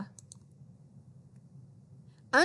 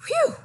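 much higher, louder whew; tired whew; 5 dB louder than usual. A narrator's reading. Reading to kids, hot tired Dog lets out a big high whew. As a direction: blow out a big relieved whew.

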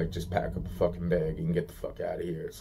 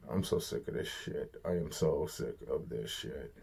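fed-up whisper, much softer; exhausted, muttered to himself; 4 semitones lower, 11 dB quieter than usual. Real, not acted. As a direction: breathe it out under your breath. Repeats the line in a whisper.

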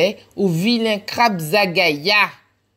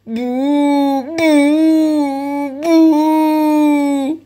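higher, louder sobbing boohoo, building; fake boohoo crying; 8 semitones higher, 6 dB louder, much slower than usual. Marilou crying in the hole, mock sobbing. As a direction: wail a cartoon boohoo. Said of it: "Elle a pleuré".

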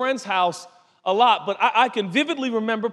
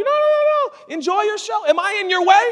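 very high, fluttery mother voice; high-pitched, eager-to-please mock mom; fussing mom impression; 12 semitones higher, 4 dB louder, faster than usual. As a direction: play an over-obliging mom serving her son. Friend's mother fussing over her son.

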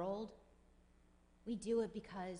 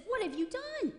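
indignant outcry; 11 semitones higher, 4 dB louder than usual. Retold or imitated. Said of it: "he says".

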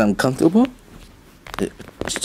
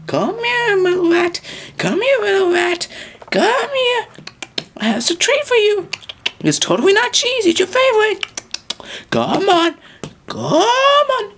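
much higher sing-song lure; coaxing squeaky voice. Acted. Luring the mouse with a fake treat, high coaxing. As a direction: coax the mouse out sweetly.

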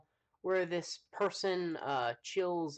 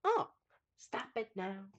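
quoting sketch voice; 9 semitones higher, slower than usual. Retold or imitated. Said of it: "I said".

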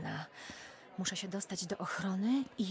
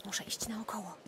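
whisper. Acted. Sneaking around guards.

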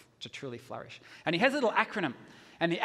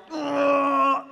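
long, high, loud "ugh"; loud mock groan; 9 semitones higher, 12 dB louder than usual. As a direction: let out a big drawn-out groan. Stunned dad's groan after rebuke.

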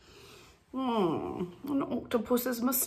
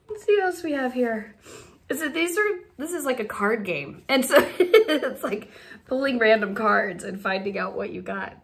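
ordinary delivery

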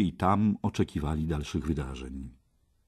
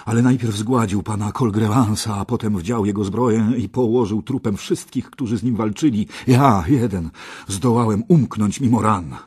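wounded knight gasping out his report, louder; louder voice of a wounded man catching his breath; panting, louder; 4 semitones higher, 8 dB louder than usual. A narrator's reading. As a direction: push the words out loudly, short of breath. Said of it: "odparł dysząc ciężko Mordred".

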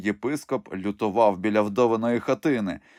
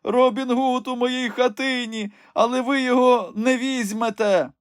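put-on high old crone voice, defiant; defiant old woman; 4 dB louder than usual. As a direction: taunt the bishop in a crone voice. Old woman defies the bishop. (зухвалий високий старечий голос)

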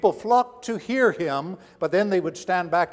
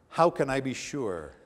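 normal talking voice

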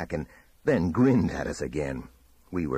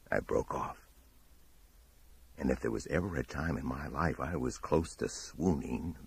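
plain reading voice

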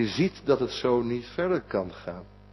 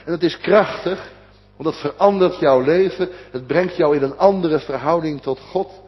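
louder, raised, insistent pulpit voice; fervent preaching; 4 semitones higher, 5 dB louder than usual. Acted. Preacher proclaiming the gospel's power to the congregation, fervent and loud. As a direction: preach it with rising conviction.

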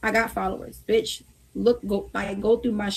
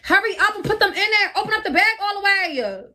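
high, raised voice barking orders at a friend; impatient higher shouted commands; bossy yelling orders; 4 semitones higher than usual. Real, not acted. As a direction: boss her around, exasperated and pitched up. Bossing friend through hot dog contest.

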